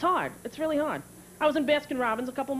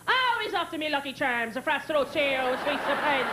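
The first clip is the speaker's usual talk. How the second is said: put-on cereal-ad Irish accent; mock Irish brogue. Friends make fun of dad, mock Irish brogue. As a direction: mimic a thick Irish brogue teasingly. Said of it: "my friend used to make fun of him".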